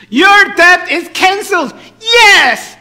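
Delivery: high, loud preacher's shout; triumphant proclamation. Acted. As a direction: proclaim the good news at full volume. Preacher proclaims the debt cancelled.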